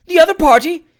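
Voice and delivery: high, loud shocked cry; alarmed exclamation. Acted. Trott learning someone waits in the chaise, alarmed cry. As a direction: recoil in alarm. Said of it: "exclaimed Trott".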